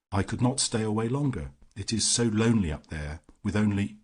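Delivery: usual speaking voice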